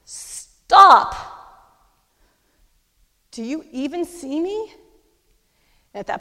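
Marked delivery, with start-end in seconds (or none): 0.1-4.7 husband yelling stop, high and very loud; retold furious shout. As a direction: bellow it like a husband at the end of his patience. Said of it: "but he does this".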